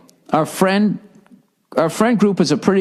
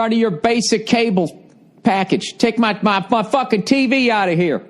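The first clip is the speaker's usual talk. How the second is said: higher, bossy landowner voice; mock threatening landowner, pitched up; Ted Turner; 6 semitones higher than usual. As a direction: order trespassers off your land, hammed up. Acts Ted Turner ordering people off.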